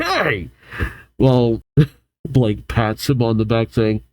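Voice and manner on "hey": high, mildly angry exclamation; a contained, acted flare of temper. A narrator's reading. Boy objecting to the girls' teasing, mildly angry. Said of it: "Hugh grows angry".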